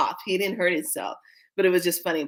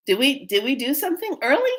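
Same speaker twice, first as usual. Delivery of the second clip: high-pitched puzzled questioning; baffled xenomorphs; 8 semitones higher than usual. Pretend. Xenomorphs wondering what they did, puzzled.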